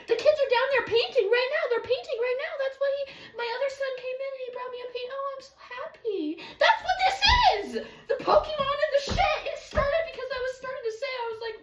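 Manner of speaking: high, gushing happy excitement; really hyped up. Real, not acted. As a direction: burst out loud and high with joy. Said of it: "I got really hyped up".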